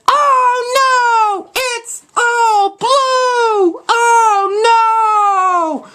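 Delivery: very high, drawn-out mock wail; high, stretched-out hammed-up cry of defeat; wailing villain. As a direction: sob it like a beaten cartoon villain. Villain cries in dream sequence.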